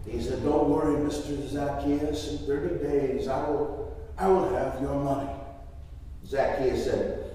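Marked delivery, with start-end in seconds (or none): 0.4-5.3 low, soft humble promise; calm the collector quietly; meek debtor's soft assurance; reassuring. Acted. Blind man reassures the collector.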